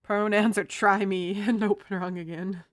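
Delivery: loud, playful bravado; loud joking. Real, not acted. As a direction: play it big and loud. Joking bits, getting louder.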